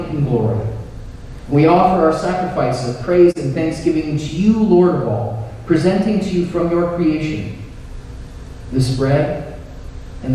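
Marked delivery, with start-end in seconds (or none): none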